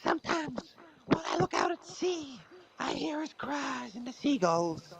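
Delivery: high, slow, swinging between wail and whisper; hammed-up tragic patient; melodramatic moth monologue. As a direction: overact a tragic confession.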